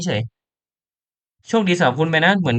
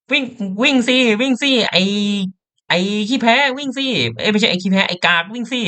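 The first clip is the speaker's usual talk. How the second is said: much higher, urging to run; excited yelling; 7 semitones higher than usual. Real, not acted. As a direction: cheer him on to run. Yelling at fleeing character. (ตะโกนเร่งให้วิ่งอย่างตื่นเต้น)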